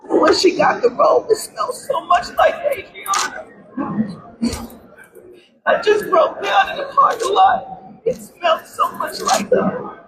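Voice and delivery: high, strained grief, near tears; voice breaking. Real, not acted. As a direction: her voice cracks with real grief. Grieving mother breaks down recounting.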